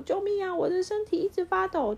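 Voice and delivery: high-pitched panicky call; loud cry for help. Acted. Toto the vacuum calling for help, high panicky cry. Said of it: "然后托托大声说".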